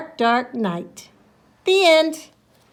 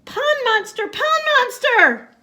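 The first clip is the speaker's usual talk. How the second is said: panicked shout; 11 semitones higher, 7 dB louder than usual. Acted. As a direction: scream it as you run away. Fleeing in panic.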